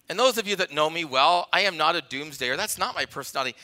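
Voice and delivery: normal talking voice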